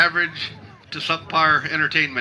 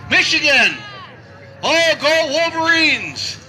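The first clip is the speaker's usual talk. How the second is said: loud, high crowd-rousing holler; high-pitched cheer belted to the crowd; rousing shout; 12 semitones higher, 4 dB louder, slower than usual. Real, not acted. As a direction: shout it like a fan cheering the team. Rousing the crowd about Michigan.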